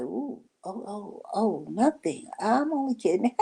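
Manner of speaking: high nervous stammer, taking it back; mock backpedalling. Retelling how she backed off from her friend.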